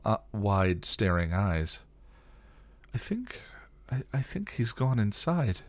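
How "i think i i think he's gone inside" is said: high, soft tentative voice; small, uncertain voice; hesitant. A narrator's reading. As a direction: venture it softly, haltingly. Shaken friend hesitantly guessing.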